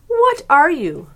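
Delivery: "What" hit high and loud, slow; pointed question. A narrator's reading. Turtle staring at Myrtle, "What" stressed high. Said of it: "the turtle asked".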